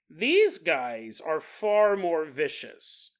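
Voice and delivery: ordinary delivery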